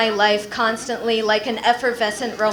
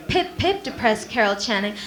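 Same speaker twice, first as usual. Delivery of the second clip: mock British with a lisp; chirpy pip pip, then droop into a lisp; British accent. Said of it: "went to London with Depressed Carol Channing".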